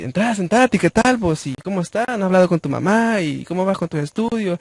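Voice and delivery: cheerful uncle. Retold or imitated. Uncle greeting his nephew with questions, high and cheerful. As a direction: bounce the questions up high with friendly energy. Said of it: "ya sabe el modo de mi tío".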